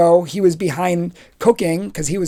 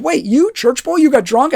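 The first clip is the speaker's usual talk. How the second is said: much higher, gleeful shock; shocked disbelief; 7 semitones higher than usual. Retold or imitated. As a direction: catch him out. Joe shocked at fake confession.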